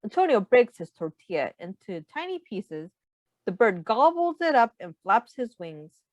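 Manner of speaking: usual speaking voice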